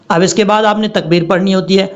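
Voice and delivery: usual speaking voice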